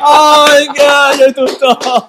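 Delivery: high, loud laughing exclaim. Real, not acted. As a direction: squeal it through laughter. Laughing outburst.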